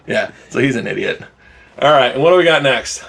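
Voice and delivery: ordinary delivery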